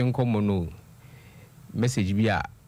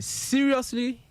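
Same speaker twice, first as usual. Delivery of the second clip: incredulous seriously; much slower than usual. Retold or imitated. Host reading a listener's shocked comment, leaping up incredulous. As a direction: voice leaps high in disbelief.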